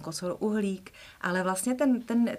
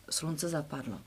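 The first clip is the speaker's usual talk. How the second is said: ordinary talk, nothing marked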